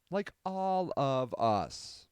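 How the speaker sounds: "Like all of us" drawn out word by word; slow, measured repeat; slow and deliberate. Real, not acted. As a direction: stretch out "Like all of us" slowly for emphasis. Repeats the phrase slowly for emphasis.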